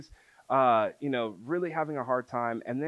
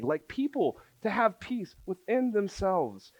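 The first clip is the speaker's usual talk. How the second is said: high, impassioned preaching run; rising fervent sermon cadence; fervent conviction; 6 semitones higher than usual. Retold or imitated. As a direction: proclaim it like a revelation. Fervent vision of heaven on earth.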